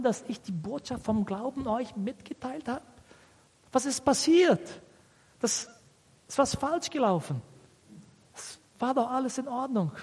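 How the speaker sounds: high, softened voice of dismay; disappointed. Acted. Paul asking the Galatians what went wrong, disappointed disbelief. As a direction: act baffled and let down, pitch held high. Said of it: "Paulus war wirklich sehr enttäuscht".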